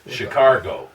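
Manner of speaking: Cincinnati-style Chicago with an added r, "Shi-CAR-go"; Cincinnati pronunciation. Retold or imitated. Recalling Cincinnati people, Cincinnati pronunciation with an r. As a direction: put a Cincinnati r into the middle of Chicago. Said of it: "they said Chicago".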